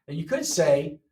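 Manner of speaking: normal talking voice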